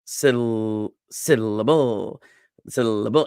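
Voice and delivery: drawn-out "syllable" as SIL-uh-BOOL; weight both ends of "syllable", light middle; stress on SI and BO. Shown as a demonstration. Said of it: "emphasize si and bo".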